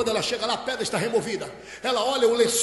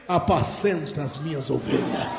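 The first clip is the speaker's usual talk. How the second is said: solemn charge; 9 semitones lower than usual. Acted. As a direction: lower and hush the voice to commission someone. Jesus gives Peter his solemn charge.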